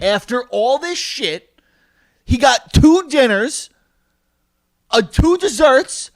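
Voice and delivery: slow, high, hammered-out list; indignant, drawn-out tallying; outraged emphasis. Real, not acted. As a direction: stretch TWO each time in high disbelief. Teller outraged at small tip.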